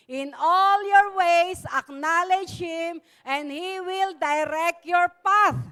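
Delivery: slow, loud, powerful verse recitation. Shown as a demonstration. Preacher leading the church in reciting the verse, loud and slow. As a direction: declare each word slowly with force. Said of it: "Lakasan natin. May power".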